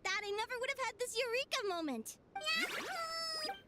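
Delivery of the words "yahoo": high, gleeful whoop of joy; happy cheer. Acted. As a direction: cheer it out, over the moon. Cheering after her eureka moment.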